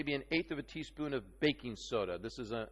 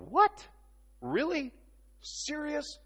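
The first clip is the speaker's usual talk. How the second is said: high-pitched mock disbelief, word by word; incredulo; 13 semitones higher, slower than usual. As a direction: play a stunned listener reacting in short, high questions. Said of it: "And you're going".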